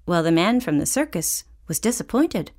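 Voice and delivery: plain reading voice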